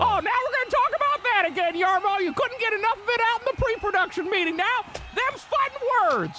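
fast, high squeaky rant. Acted. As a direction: rattle off a squeaky challenge. Picking a fight in silly voice.

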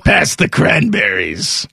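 slow, put-on talk-host voice; drawn-out celebrity impression at the holiday table; Larry King. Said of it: "Larry King turned into Jiminy Glick".

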